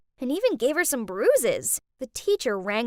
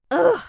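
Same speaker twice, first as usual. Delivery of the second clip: frustrated ugh; 7 semitones higher, 7 dB louder than usual. Acted. Frustrated teen groan.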